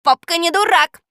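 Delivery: high, loud parrot cry; loud parrot shout. A narrator's reading. Parrot defends himself loudly, shouting. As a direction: squawk out the indignant retort. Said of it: "громко крикнул Жако".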